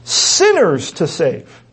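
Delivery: SINNERS put first, drawn out slowly with falling pitch; slow, literal rendering, leaning hard on sinners; emphatic SINNERS. Shown as a demonstration. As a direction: land heavily on "sinners", then finish "to save". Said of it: "translate it woodenly".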